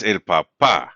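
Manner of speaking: "papá" stressed on the final pá; deliberate demo of "papá" with a strong last syllable. Shown as a demonstration. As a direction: say "papá" as pa-PÁ, punching the ending. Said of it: "here the last syllable is stressed".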